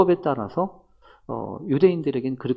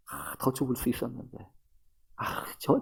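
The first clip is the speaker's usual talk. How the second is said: soft, sighing self-talk; mock regretful muttering; 2 semitones lower, 9 dB quieter than usual. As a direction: grumble under your breath, acting it out. Acts out regretful, grumbling thoughts. (한숨 섞어 나직이 원망하는 척)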